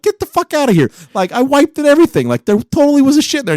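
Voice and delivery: high-pitched comic disbelief; incredulous protest. Acted. Acting out disbelief at vanished poop.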